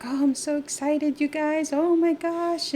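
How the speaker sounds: high, excited gushing, imitating someone; happy, bouncy. Excited sister-in-law greeting the couple early morning, gushing. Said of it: "all happy and bouncy".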